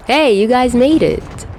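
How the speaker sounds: cheerful shout across the battlefield; glad greeting. Acted. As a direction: call out in relief as they arrive. Tony arriving at battle.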